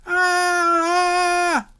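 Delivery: a sudden high, loud scream of panic; terrified scream. Acted. Disciples panicking in the storm, screaming in terror. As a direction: shriek like a man about to drown. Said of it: "summarize the reaction of the disciples with one word".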